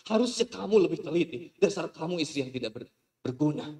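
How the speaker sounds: husband scolds his wife; scolding husband. Acted. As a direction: put her down.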